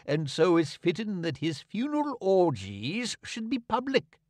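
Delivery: a hint of a preening preacher; pompous king. A narrator's reading. King addressing the mourners, lightly pompous.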